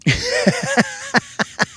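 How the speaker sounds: high, wheezy laughing fit. Real, not acted. Laughing at his own joke about his brother, real laugh.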